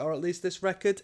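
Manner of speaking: ordinary talk, nothing marked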